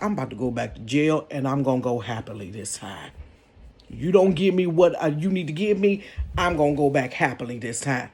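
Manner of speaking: lightly voiced menacing ex-con, quick; tough dude. Voicing the angry ex-con dude.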